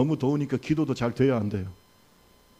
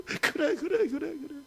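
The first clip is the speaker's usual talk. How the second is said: tender comfort; 14 semitones higher, slower than usual. Acted. God comforting gently.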